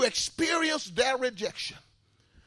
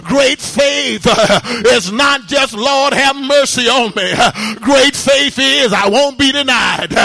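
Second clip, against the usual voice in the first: loud, rhythmic sermon delivery with uh breaths; fiery preaching; 4 semitones higher, 20 dB louder than usual. Acted. Preacher urging the church to call on God, fiery preaching. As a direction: preach it hard to the congregation.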